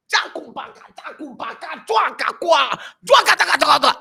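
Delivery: imitating Chinese speech with high, loud, choppy syllables; mock Chinese gibberish. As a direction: parody the businessmen rattling off Chinese. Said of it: "They are speaking Chinese".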